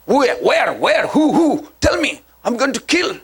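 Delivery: hammed-up excited neighbor hunting the killer, pitch jumping high; agitated neighbor.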